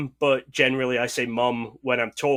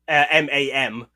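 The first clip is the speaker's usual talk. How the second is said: ordinary talk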